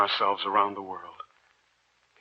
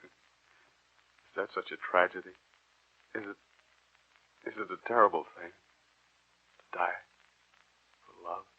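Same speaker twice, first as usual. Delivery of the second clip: bitter resignation; 4 dB quieter, slower than usual. Acted. Giovanni bitter about death.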